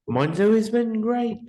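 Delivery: classy English accent. Shown as a demonstration. Bragging to a friend about the classy English accent. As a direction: show off a posh English accent. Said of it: "I can even talk like a classy Englishman".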